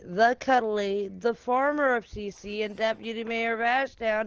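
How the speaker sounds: drawn-out ring announcer; announcer intro. Acted. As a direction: build each name up slowly. Wrestling-style player introduction.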